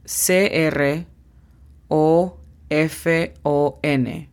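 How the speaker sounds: O F O N spelled slowly, then Microphone drawn out slowly; slow demo. Shown as a demonstration. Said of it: "La pronunciación fonética es". (demostración lenta de pronunciación)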